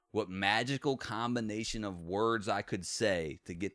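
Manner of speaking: slow, mournful words. Retold or imitated. Quoting grandpa's last words, slow and heavy. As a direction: drag it out like a dying man. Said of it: "in this long pause".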